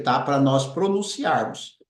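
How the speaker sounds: ordinary talk, nothing marked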